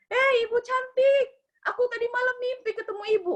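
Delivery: high sweet coaxing voice; flattering maid voice. Retold or imitated. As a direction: play the sly maid buttering up the boss. (suara pembantu merayu, nada tinggi)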